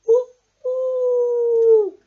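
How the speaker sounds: very high, loud hooting owl; high owl call. Acted. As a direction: hoot like a swooping owl. Owl swoops at night.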